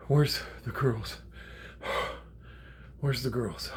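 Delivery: slow words between heavy gasps; out of breath. Retold or imitated. Grandpa reaches the men uphill, out of breath. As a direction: act winded, struggling to get words out. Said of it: "I'm trying to talk. I, I, it was so cr-, fucking hard to talk".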